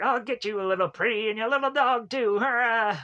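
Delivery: high witch threat with a cackle at the end; Wicked Witch of the West. Acted. Podcaster imitating a film character's impression, high witch voice. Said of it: "doing the, the Wicked Witch of the, the West".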